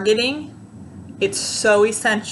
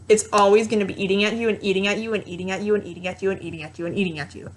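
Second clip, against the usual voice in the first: relentless; much faster than usual. Shown as a demonstration. Speaker showing how the voice keeps nagging, relentless and fast. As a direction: rattle it off without stopping, trailing down.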